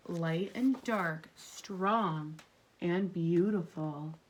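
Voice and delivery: gentle read-aloud to a class; storybook reading. Acted. Teacher reading a story aloud to her class, gently. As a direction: read it like a picture book for kids. Reads picture book to class.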